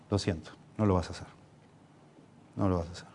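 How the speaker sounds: quiet, calm and firm refusal; a father saying no without raising his voice; soft, steady parental no; said calmly. Shown as a demonstration. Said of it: "Yo lo digo así tranquilo".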